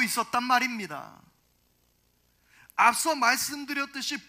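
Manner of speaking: forceful sermon delivery; emphatic preaching. Real, not acted. Preacher presses the argument.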